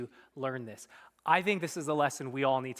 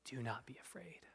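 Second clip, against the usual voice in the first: soft unvoiced reassurance; whispered comfort; 3 semitones lower, 18 dB quieter than usual. Preacher voicing Jesus to scared disciples, whispered. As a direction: hush their fear gently.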